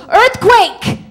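loud, high-pitched cry of false warning; mock alarm shout. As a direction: yell it out to scatter a crowd. Nixon tricks the firing squad, shouting.

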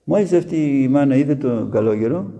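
ordinary delivery

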